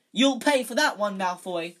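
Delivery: high, loud yell of rage; furious yell. A narrator's reading. Ron threatening Malfoy over the insult, a loud furious yell. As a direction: bellow the threat.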